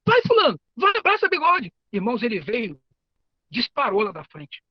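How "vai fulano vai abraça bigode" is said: high, loud shout of encouragement; classmate yelling. Retold or imitated. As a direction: yell it from the front of the class. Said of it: "o outro gritou assim". (aluno gritando alto e agudo lá da frente)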